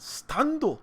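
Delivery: a hint of Japanese accent on Stando; half-baked Japanese accent. Reviewer explaining Stands to viewers, mock Japanese accent. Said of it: "half-baked, uh, Japanese accent".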